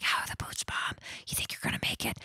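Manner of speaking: put-on whispered question from a kid; barely audible, voiceless whisper; hushed. Kids checking on mom at a quiet campsite, whispering. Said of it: "We're trying to be quiet".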